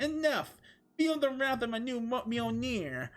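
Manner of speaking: Thor screaming. Acted. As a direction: shriek it up high in fury. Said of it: "Thor screamed".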